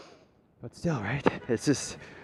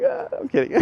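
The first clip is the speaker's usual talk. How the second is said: high laughing "just kidding". Real, not acted. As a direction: laugh the joke away, much higher. Laughs off his fake wail.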